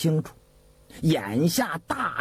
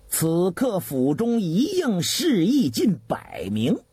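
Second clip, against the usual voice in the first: ordinary delivery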